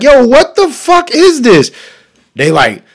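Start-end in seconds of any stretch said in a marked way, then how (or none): 0.0-1.7 high, slow horrified outburst; mock shock. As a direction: act appalled at the sink, pitched up. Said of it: "I'm like".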